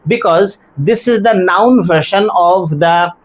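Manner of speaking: ordinary talk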